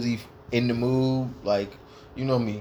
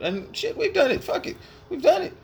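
resigned. Retold or imitated. Acted quote of giving in.

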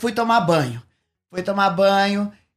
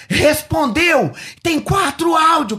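much higher, louder and slow, thrilled news; excited shout; 8 semitones higher, 4 dB louder, slower than usual. Retold or imitated. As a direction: burst out with the good news. Caretaker announces the pastor replied.

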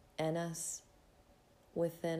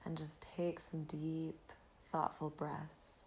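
breathy calm, guiding the listener inward; soft spa whisper; 3 dB quieter than usual. Acted. Leads a gratitude meditation.

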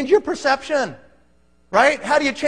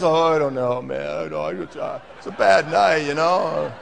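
mildly mopey, lower, trailing off; deflated; 8 semitones lower than usual. Retold or imitated. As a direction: sound like the air went out of you.

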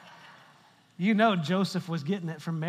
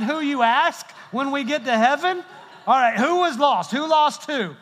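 loud preaching; 5 semitones higher, 5 dB louder than usual. Real, not acted. Preacher calling out loudly.

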